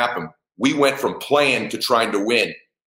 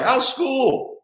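mock small talk. Mocks his former small talk as dad.

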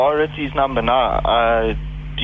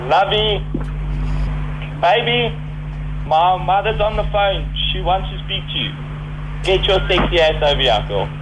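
high, raised call across the house; 6 semitones higher than usual. Pretend. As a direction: call her in, sweetly. Calling girlfriend from another room.

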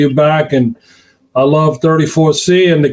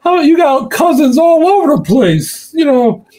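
higher, teasing voice as Larry; imitating Larry; 9 semitones higher than usual. The caller ribbing the host about his cousins, a high teasing voice. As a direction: needle him like a grinning caller. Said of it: "he's like".

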